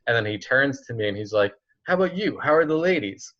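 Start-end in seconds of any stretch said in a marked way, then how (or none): 1.9-3.3 higher, put-on friend's voice asking about girls; Jason accent. Friend at the gym asking about the ladies, in a higher put-on voice. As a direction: ham up your buddy's casual question. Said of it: "That's my Jason accent".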